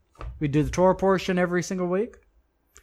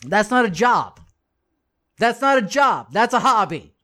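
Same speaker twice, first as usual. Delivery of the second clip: firm rebuke; 4 semitones higher, 5 dB louder than usual. Real, not acted. Preacher rebuking idle teachers.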